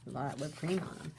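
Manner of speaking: ordinary delivery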